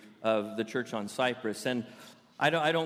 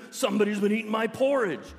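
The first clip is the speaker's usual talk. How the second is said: higher-pitched, storybook bear roar; Papa Bear roar; 5 semitones higher, 4 dB louder than usual. Acted. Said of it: "He roared".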